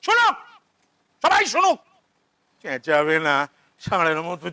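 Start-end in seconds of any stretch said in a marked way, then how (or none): 0.0-1.7 sudden high announcing shout; shouting to all. Acted. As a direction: yell so the whole house hears. Father shouts to everyone.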